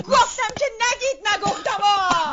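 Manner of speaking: slow, loud shout; angry outburst. Acted. Wife shouting at them not to say it, slow and loud. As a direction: hammer each word in fury.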